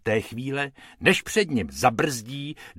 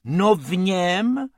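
rabbit voice; 7 semitones higher, 4 dB louder, slower than usual. A narrator's reading. Rabbit Bob speaks.

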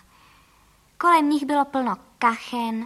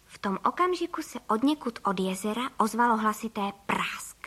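plain reading voice

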